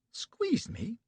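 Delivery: high, scandalised question; shocked echo. A narrator's reading. Betty repeats his word, high and shocked. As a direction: recoil at the word squeezed.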